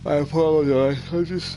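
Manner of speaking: talking through a yawn; words stretched by a yawn. Real, not acted. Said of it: "I can't stop yawning".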